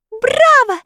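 very high shrill cheer; little folk cheering wildly; excited cheering. A narrator's reading. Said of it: "хлопали в ладоши и кричали человечки".

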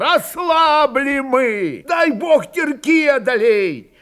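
high, drawn-out desperate yell; straining shout. A narrator's reading. Fyodor warns that they have weakened, straining to outshout. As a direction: bellow against a crowd drowning you out. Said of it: "Но ему было не перекричать".

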